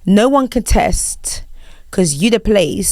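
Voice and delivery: slow formal lyric reading. Shown as a demonstration. Host repeating the clue for guest, formal reading. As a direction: recite slowly with no tune. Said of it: "such a formal H R voice".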